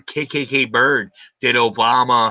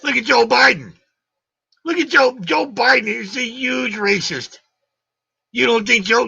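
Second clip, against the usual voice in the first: angry shout; 10 semitones higher than usual. Real, not acted. Shouting in heated argument.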